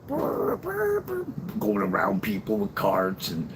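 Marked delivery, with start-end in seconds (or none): 0.1-1.0 high buzzing engine imitation; trilled lips, motor revving; race car noise. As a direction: rev like a kid playing race cars. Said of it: "take off like a race car driver".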